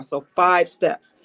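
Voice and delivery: each word drawn out slowly; slow emphasis. Real, not acted. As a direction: spell out the count deliberately. Announces list of steps.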